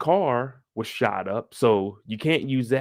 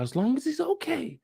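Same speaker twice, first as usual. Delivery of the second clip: much higher, soft motherly voice; mock Lucille; 14 semitones higher, 6 dB quieter than usual. Lucille soothing about her son, high soft mock voice. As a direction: sigh it like a worried mother.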